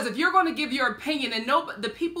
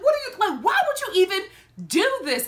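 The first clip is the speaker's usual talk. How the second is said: high, indignant imitation of a seatmate; 9 semitones higher, 4 dB louder, faster than usual. Squeezed seatmate confronting the lady, high mock indignation. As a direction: pitch it up in mock disbelief.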